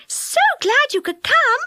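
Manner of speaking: squeaky glad fairy greeting; high fairy voice. Acted. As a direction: voice Silky high and delighted. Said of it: "cried Silky".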